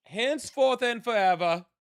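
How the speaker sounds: high-pitched, easier-going medieval herald. Pretend. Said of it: "I'll try to do a medieval voice".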